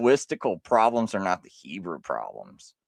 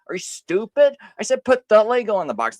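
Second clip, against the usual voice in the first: higher, louder, fast scolding yell; hammed-up yell at the kid; mock angry parent; 8 semitones higher, 5 dB louder, faster than usual. Parent yells at the kid over the Lego, mock angry.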